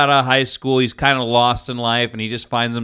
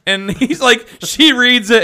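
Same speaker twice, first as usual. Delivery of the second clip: normal talking voice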